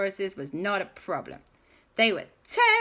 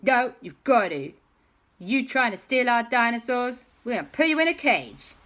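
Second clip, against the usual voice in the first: loud, raised-pitch bossy order; threatening; 6 semitones higher, 7 dB louder than usual. Acted. Mean guys forcing captives into a cage, threatening. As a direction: lay down the law like a story villain.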